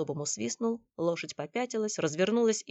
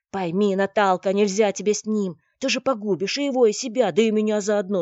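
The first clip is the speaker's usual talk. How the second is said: ordinary delivery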